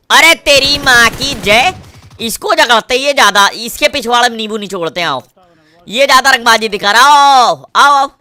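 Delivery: loud, high frantic yelling; heated shouting. Real, not acted. Gamer in a firefight, loudly taunting the enemy player. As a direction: bark at the enemy mid-fight.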